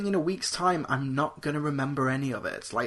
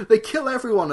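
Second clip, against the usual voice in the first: ordinary delivery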